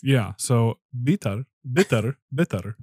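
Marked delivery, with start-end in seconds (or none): 1.0-2.7 put-on German accent, amused. Shown as a demonstration. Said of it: "But still with an accent".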